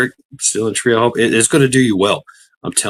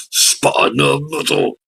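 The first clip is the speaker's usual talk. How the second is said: higher, slow announcer call; hyped announcer take; drawn-out call; 6 semitones higher, slower than usual. Acted. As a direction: stretch the name out high. Second take of the name.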